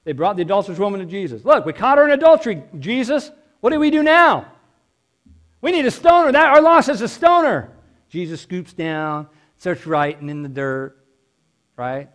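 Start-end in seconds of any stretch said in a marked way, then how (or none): 1.5-7.7 much higher and louder, fast and urgent; the crowd demanding judgment, pushing hard; agitated accusers. Acted. Accusers voiced bringing the woman.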